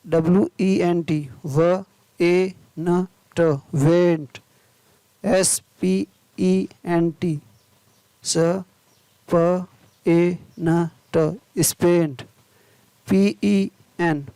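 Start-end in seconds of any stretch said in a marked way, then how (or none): 1.5-3.4 slow, separate phonics sounds; word broken slowly into its sounds; sounding out. Shown as a demonstration. Phonics teacher sounding out spelling. 8.2-11.2 slow, separate phonics sounds; word broken slowly into its sounds; sounding out. Shown as a demonstration. Phonics teacher sounding out spelling.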